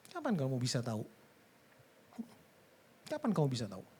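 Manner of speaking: ordinary talk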